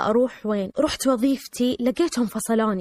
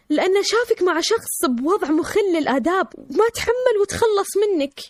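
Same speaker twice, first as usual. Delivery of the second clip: mother's high, wailing disbelief; retold tearful outcry; 5 semitones higher than usual. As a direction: lament it in a raised pitch, as if crying. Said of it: "قامت أمي تصرخ وتبكي وتحضني وتقول".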